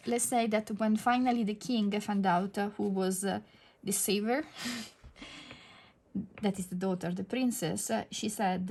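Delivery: ordinary talk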